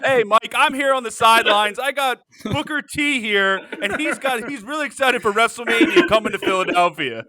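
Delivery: loud, high sideline report; sideline reporter. Pretend. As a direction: hype it like live TV. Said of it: "like, a live reporter".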